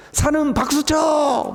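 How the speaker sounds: high, drawn-out command; calling out. Acted. Preacher ordering mountains to clap, drawn-out cry. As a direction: call slowly across to the mountains.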